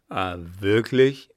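loud, heavy challenging question; drunken agitation. A narrator's reading. Clown trembling with schnapps answers her threat, agitated. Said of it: "zitternd vor Schnaps und Aufregung".

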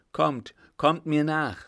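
rally them with an urgent call; urgent command. Acted. Lieutenant hurrying his party along, loud and urgent. Leader urges party to follow.

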